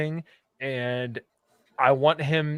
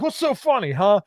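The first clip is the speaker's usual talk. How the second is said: angry; 5 semitones higher than usual. Retold or imitated. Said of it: "legitimately mad".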